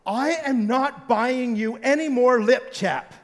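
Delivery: wife turning on her daughter, imitated harsh and slow. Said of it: "in a bit of a harsh tone".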